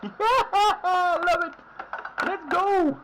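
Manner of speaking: high, loud joyful shout; joyful yell. Real, not acted. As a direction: laugh and cheer loudly. Celebrating goal.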